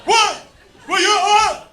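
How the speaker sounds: high, loud offended disbelief; stunned brother. Retold or imitated. Brother insulted by the quarter advice, loud high shock.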